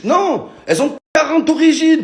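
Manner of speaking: high, loud exasperation; exasperated outburst. Real, not acted. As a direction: blow up in disbelief.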